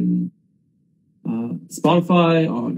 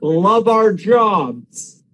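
slow, higher-pitched mock enthusiasm; sarcastic; 6 semitones higher, slower than usual. Real, not acted. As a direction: draw it out with heavy sarcasm. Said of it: "there's a lot of sarcasm going on".

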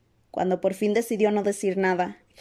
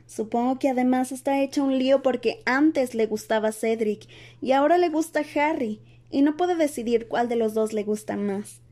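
put-on higher-pitched voice of a knowing girl; higher character voice, earnest lecture; Hermione explaining patiently; 4 semitones higher than usual. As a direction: lay it all out point by point.

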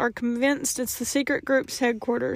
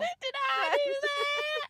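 Urkel impression; 8 dB quieter, much slower than usual.

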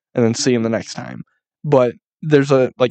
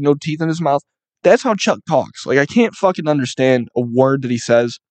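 normal talking voice

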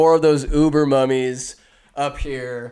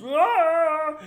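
high drawn-out "ah"; mock battle cry; 13 semitones higher than usual. Storyteller acts Rick rushing to save Evie, mock battle cry. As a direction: imitate the hero bursting in with a yell.

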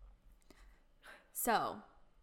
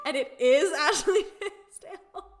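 louder, higher, through laughter; laughing; 6 semitones higher, 10 dB louder than usual. Real, not acted. Fan laughing to her friend about the meta joke. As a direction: crack up mid-sentence.

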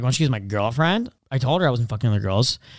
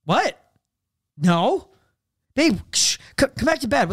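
higher, mock-innocent denial; hammed-up sleepy innocence; faking innocence; 8 semitones higher, slower than usual. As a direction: brush it off like nothing happened. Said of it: "And I'm like".

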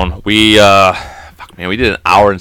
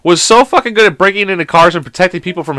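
exasperated comic rant; 8 semitones higher than usual. Real, not acted. Host demanding to know who Jimmy was, exasperated rant. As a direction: rant in baffled outrage, voice pitched up.

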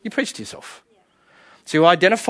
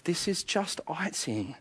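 soft, slow, quiet reminder; quiet self-reminder; 14 dB quieter, slower than usual. Shown as a demonstration. Preacher modelling a quiet self-talk reminder. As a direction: say it slowly, softly, to yourself. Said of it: "say to yourself".

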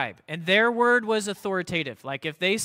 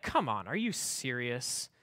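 low, slow, dismissive scoff; condescending scoff; 7 semitones lower, 8 dB quieter, slower than usual. Retold or imitated. Pharisees scoffing at Jesus in a low condescending voice. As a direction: talk down to Jesus. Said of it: "they're talking down".

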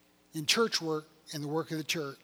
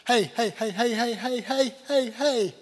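insistent, louder string of calls; calling for attention; 3 semitones higher, 4 dB louder than usual. Acted. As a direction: break in repeatedly to get their attention. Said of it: "I just wonder at times if God says".

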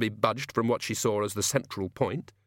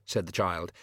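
ordinary delivery, nothing marked

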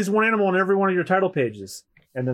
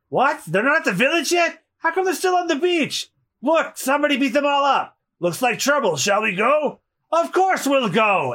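high, eager pirate sidekick voices; cartoon cat pirates; 9 semitones higher than usual. Two voiced pirate characters.

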